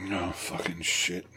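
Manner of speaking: hoarse, slow near-whisper; raspy whisper. Real, not acted. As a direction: rasp it out with no voice. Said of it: "I have no voice now".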